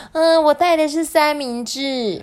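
high, loud, gleeful character voice; happy. Acted. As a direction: pipe up happily as a little classmate. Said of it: "番茄也高兴地说".

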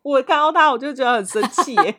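high, loud, heated complaint; real anger. Real, not acted. Venting to a friend about an ungrateful coworker, heated. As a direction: let the anger flare up.

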